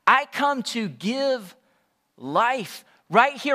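ordinary talk, nothing marked

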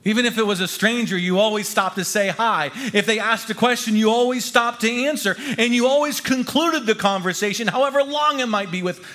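high-pitched, lively, insistent telling; animated memoir reading. A narrator's reading. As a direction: build it up as a proud list of customs from home. Animated account of home customs.

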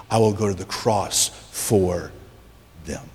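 low, slow, weighted declaration of love; measured low voice with pauses before the last word; tender, solemn Christ. Acted. Voicing Christ's tender, resolute love.

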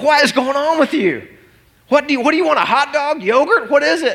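high, frantic questioning; acted-out dad at his wits' end; exasperated parent. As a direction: fire off pleading questions in a high pitch. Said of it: "I'm like".

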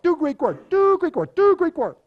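put-on high, loud parody of Greek accent; groomsmen mimicking Greek accent. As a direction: chant it like friends mocking a Greek preacher. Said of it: "had been talking about this for weeks".

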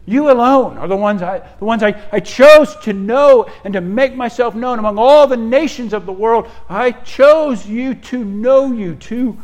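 loud, high preaching as God's voice; impassioned proclamation. Acted. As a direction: proclaim God's choice with full force. Paraphrases God speaking in first person.

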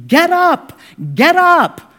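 shrill screaming get up. Retold or imitated. Preacher screaming at a coffin, high loud shout. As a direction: yell it at a corpse to wake. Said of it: "scream at the top of your lungs".

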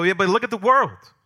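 high, forceful imitated pushback; mock protest. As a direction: argue back like an exasperated doubter. Voices a doubter objecting to God's control.

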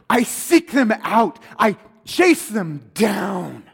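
slow, deliberate Jesus lines; a touch of Jesus. Acted. Preacher voicing Jesus seeking sinners, slow and firm. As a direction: lay each word down slowly and firmly. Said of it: "And Jesus says".

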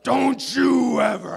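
slow, high imitated angry shout; ripping into them. Chancellor ripping into student pastors, acted furious shout. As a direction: roar a warning like a furious preacher. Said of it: "just rips into us".

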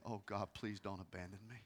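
very soft, low plea; hushed pleading; retold tearful prayer. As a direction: pray quietly through tears. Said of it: "I'm crying".

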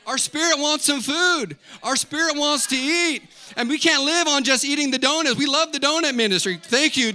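loud, high-pitched exhortation; full-force preaching. Real, not acted. Pastor urging congregation to feed their spirit, full-force preaching. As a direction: cry out the need to the whole room.

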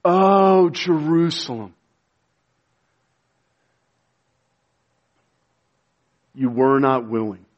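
preacher's sighing lament for Jerusalem. Acted. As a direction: sigh out the grief over the city. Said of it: "here's this sighing".

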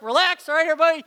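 high, loud rallying call to settle everyone; calming the group. Retold or imitated. Leader rallying anxious students before worship, loud and high. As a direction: call them in like a coach taking charge. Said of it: "And I'm just like".

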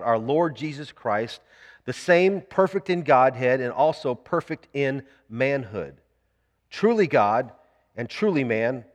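slow, deliberate creed reading. Real, not acted. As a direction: read it out slowly, phrase by phrase, so listeners can follow. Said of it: "read this real slowly".

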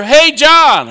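cheerful neighbor calling across yards, friendly yell; retold yell over. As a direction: call out to a neighbor at a distance. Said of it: "he yells over".

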